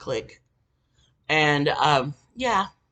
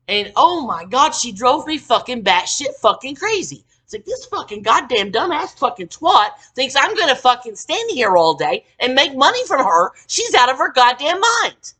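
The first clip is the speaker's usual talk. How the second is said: loud, high, worked-up venting; loud angry venting; furious rant; 5 semitones higher, 8 dB louder than usual. Real, not acted. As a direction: boil over with outrage. Furious rant about coworker.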